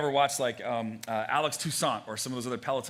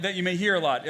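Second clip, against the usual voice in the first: ordinary talk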